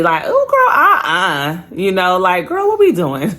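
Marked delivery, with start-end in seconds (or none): 0.3-1.7 playfully scandalized, acting out old judgment; judgy. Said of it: "I remember being judgy". 2.5-3.3 high-pitched, playful disbelief; mock-scandalized girl talk; judgy. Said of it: "I remember being judgy".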